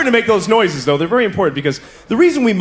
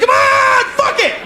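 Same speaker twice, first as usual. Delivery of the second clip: high, loud shout; yelling lover; 3 dB louder, slower than usual. Retold or imitated. Woman urges her partner on, high loud shouts. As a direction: yell it to urge him on. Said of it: "She's like".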